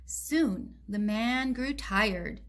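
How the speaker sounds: plain reading voice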